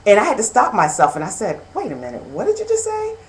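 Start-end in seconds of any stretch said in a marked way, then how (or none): 1.7-3.2 high-pitched double take; startled self-questioning. Retold or imitated. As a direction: catch yourself out, pitch leaping up. Stopping herself mid-thought.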